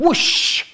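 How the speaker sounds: whooshing blast noise; imitated whoosh. Storyteller imitating the bombs going off, whooshing. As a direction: breathe out an explosion whoosh.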